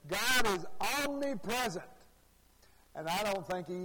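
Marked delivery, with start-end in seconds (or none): 0.1-1.8 higher, emphatic preacher proclamation; imitated excited preacher. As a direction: mimic a worked-up preacher declaring his slogan. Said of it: "he changed it one time and says".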